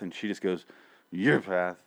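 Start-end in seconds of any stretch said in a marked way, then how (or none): 1.1-1.8 mock grunting mumble; grunted imitation. Man imitating a TV character's hard-to-hear reply, grunted. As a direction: grunt it out, words run together. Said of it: "'cause she grunts".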